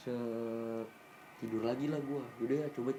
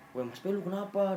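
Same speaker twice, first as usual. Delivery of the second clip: mock frightened call, pitched up; imitating a scared friend; 7 semitones higher than usual. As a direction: press him again, more alarmed. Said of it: "si Andre ketakutan".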